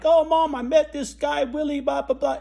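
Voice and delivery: put-on high, loud, eager little boy running to Mom; excited kid. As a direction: burst in like an excited child telling news. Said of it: "and was like".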